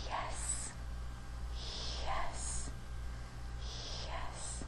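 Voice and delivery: whispered yes, no voice. Shown as a demonstration. Teacher whispering yes for students to copy. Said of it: "whisper the word yes with me".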